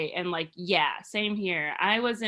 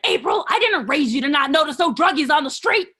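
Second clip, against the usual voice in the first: louder, higher scolding mom voice with a put-on accent; mad mom impression; 5 semitones higher, 9 dB louder than usual. Mom angrily scolding April about druggies. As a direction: scold your daughter like an angry mom. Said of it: "she talks like that".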